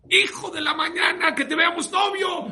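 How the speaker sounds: grito agudo y sostenido de mamá enojada; mamá gritando. Retold or imitated. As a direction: shout it high and loud, like a cross mother blessing her kids. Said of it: "pero gritándoles". (gritándoles a los hijos)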